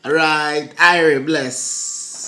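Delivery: put-on Jamaican patois; Jamaican accent. Blessing a passer-by in a Jamaican accent. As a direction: sign off like a Jamaican. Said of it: "You just did that accent".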